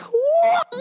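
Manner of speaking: high, sharp disbelieving cry; shocked what. Retold or imitated. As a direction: squeal it in outrage. Shocked reaction to insult.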